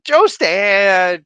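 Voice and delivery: loud, delighted hello to a viewer; excited greeting. Real, not acted. Streamer greets a viewer in chat excitedly. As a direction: call his name with joy.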